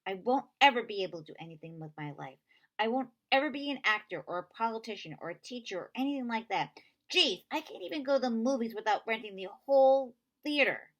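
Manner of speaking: loud frustrated rant; upset outburst. Acted. Connor ranting to Avon about his life, loud and bitter. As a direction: vent it all loudly. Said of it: "Connor ranting, jumping up".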